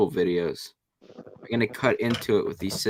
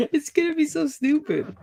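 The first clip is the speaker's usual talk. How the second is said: laughing it out in a much higher voice. Real, not acted. Filming her wax project, she laughs the words out to her friend. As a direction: giggle through the words.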